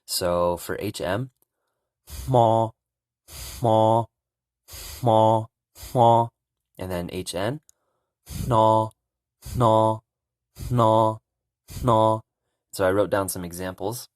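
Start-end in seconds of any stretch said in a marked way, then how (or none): 2.1-6.3 "hmo" with air blown through the nose before the M; nasal puff then "hmo"; teacher drilling voiceless M; Hmong hmo. Shown as a demonstration. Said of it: "blow air through your nose and then say the consonant M". 8.2-12.2 "hno" with air blown through the nose before the N; nasal puff then "hno"; teacher drilling voiceless N; Hmong hno. Shown as a demonstration. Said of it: "blow air through your nose and then say".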